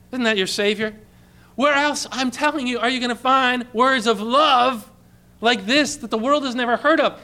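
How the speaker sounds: ordinary delivery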